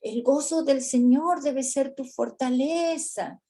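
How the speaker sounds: "gozo" stressed in a lightly hammed-up preacher tone; mock pep talk. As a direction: recite the verse brightly as a cheer. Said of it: "Otras veces le decimos".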